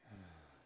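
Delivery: short, low, soft exhale; gentle falling out-breath; soft releasing sigh. Shown as a demonstration. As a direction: quietly sigh the stress out. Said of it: "allow the earth to absorb all the stress".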